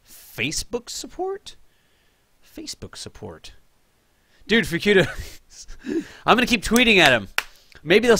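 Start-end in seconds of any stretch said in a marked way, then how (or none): 0.0-1.5 higher, slow, rising incredulous query; Facebook support?, pitched up and drawn out; puzzled question. Real, not acted. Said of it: "Meg twelve doing Facebook support". 2.4-3.5 lower, much softer, flat under-breath repeat; Facebook support., dropped low and murmured to himself; quiet mutter. Real, not acted. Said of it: "Meg twelve doing Facebook support".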